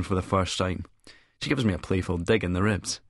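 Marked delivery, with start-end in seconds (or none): none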